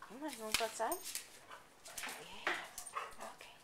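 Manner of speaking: usual speaking voice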